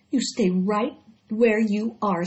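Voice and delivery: slow, firm mother voice. Acted. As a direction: plant her firmly in place. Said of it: "said her mother".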